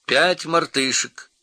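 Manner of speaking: high, loud, slow announcement; announcing parrot. A narrator's reading. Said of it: "объявил попугай".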